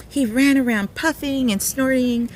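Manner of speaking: plain reading voice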